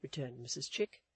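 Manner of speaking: ordinary delivery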